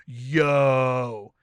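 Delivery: loud, drawn-out mock shock; stunned exclamation. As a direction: stretch it into a big stunned holler. Stunned reaction in retold story.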